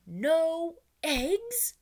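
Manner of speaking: loud, high shocked cry of "No eggs?"; cried out. A narrator's reading. Farmer crying out over his missing eggs, loud and high. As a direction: wail it out in disbelief. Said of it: "cried Farmer Brown".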